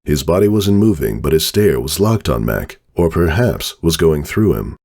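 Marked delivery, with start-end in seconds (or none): none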